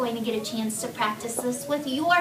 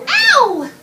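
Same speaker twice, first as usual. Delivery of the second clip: high, loud yelp; cried out; 12 semitones higher, 10 dB louder than usual. Shown as a demonstration. Teacher shows class how the word cried changes voice, cried out. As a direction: cry out sharply as if hurt. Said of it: "we changed it from cried and we didn't just say said".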